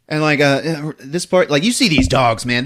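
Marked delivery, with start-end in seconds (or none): none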